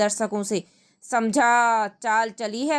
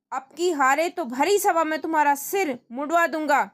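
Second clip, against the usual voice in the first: raised, menacing king's voice; threatening king; 6 semitones higher than usual. Acted. King threatening to shave Tenali's head, raised voice. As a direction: threaten the courtier from the throne.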